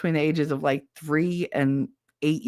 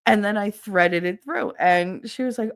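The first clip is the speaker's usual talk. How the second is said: ordinary delivery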